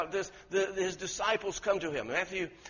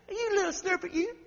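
much higher, mock-gentle little scold; dainty scold; 13 semitones higher, 3 dB louder than usual. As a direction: make it sound harmless and cute. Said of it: "He didn't say".

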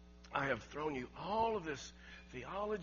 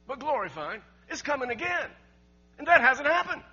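much louder and higher, hammering each phrase; preaching at full force; 8 semitones higher, 13 dB louder than usual. Acted. Preacher proclaiming the second coming to the congregation, fervent shout. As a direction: build the sermon to a shouting climax.

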